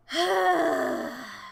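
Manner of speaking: long weary exhale; sigh. Real, not acted. Streamer winds down after stressful story, weary sigh. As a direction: let out a long breathy sigh.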